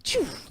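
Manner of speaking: quick puffed "choof"; short airy whoosh; crossbow shot noise. Acted. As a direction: imitate a bolt being fired. Imitates a hand crossbow firing.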